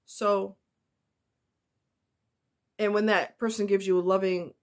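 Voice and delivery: usual speaking voice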